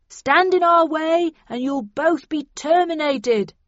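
loud, slow megaphone yell; bullhorn yell. A narrator's reading. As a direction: bellow it through a bullhorn. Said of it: "yelled the bullhorn again".

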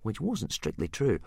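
ordinary delivery, nothing marked

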